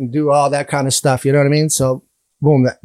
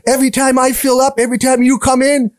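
high, whiny mock shopkeeper voice; clerk's complaint; 9 semitones higher, 3 dB louder than usual. Store clerk complains about the missing sandwiches, high mock whine. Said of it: "He goes".

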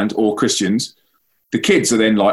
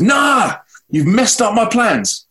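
much higher, slow, a touch of a teenage girl's mock shout; girl's blunt retort; 11 semitones higher, much slower than usual. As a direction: voice the upset girl telling him off. Girl answering, upset.

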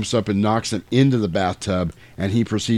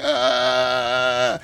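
long held wail; drawn-out cry of someone being shocked; mock electrocution cry. Vampire electrocuted by the stereo, mock held cry. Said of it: "starts electrocuting him".

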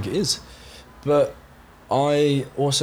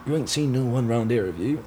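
put-on a lightly done broad Somerset accent, a local asking around; a hint of a West Country villager in broad Somerset. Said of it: "proper Somerset".

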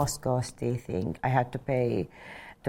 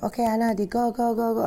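much higher, eager chant of go; cheering excitedly; 10 semitones higher, faster than usual. Retold or imitated. Prince cheers the pilot on during takeoff, excited. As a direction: cheer her on like a thrilled passenger.

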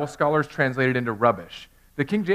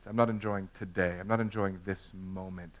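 softer, sinking confession; quiet confession; 4 semitones lower, 9 dB quieter than usual. Real, not acted. Pastor admitting his failure to the church, hushed. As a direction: let it drop to a hush. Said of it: "I'm not living for today's phase".

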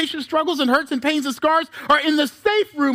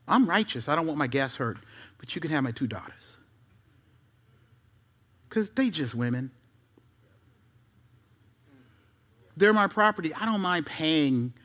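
mock self-righteous Lot; 11 semitones lower, 6 dB quieter than usual. Preacher voices Lot's thoughts.

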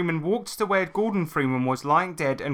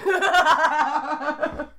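loud laugh; 14 semitones higher, 4 dB louder than usual. Real, not acted. Bursts out laughing.

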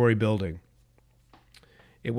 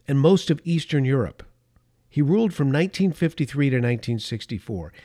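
ordinary delivery

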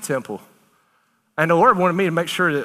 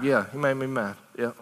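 softer, lower admission; soft confession; 5 semitones lower, 6 dB quieter than usual. Acted. God admitting his hurt to his people, soft and low. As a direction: let it fall quietly, almost hurt.

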